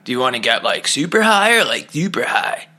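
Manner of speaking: a lightly voiced stoner clerk with a squeaky peak; stoned shop worker. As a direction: mimic a dopey clerk offering two identical choices. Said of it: "the guy's like".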